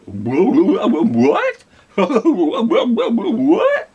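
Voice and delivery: high, loud laughing what; laughing surprise. Real, not acted. Reacting to a child's answer.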